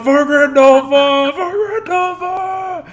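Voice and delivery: very high, slow, stretched-out shouts; rallying cry soaring up into a high wail; echoed battle cries. Acted. Game master voicing answering cries from another room, shouted battle cries. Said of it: "when you shout out for Grandolfa".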